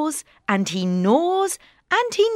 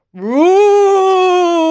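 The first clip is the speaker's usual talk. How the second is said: big high loud roar; lion roar; 9 semitones higher, 8 dB louder than usual. Acted. Lion waking up roars at the mice, loud roar. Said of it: "he roars".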